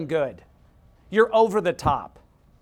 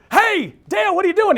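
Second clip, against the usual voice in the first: loud, high acted greeting; calling back; 12 semitones higher, 10 dB louder than usual. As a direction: shout back to a fellow driver, hammed up. Rival driver answering the shouted call.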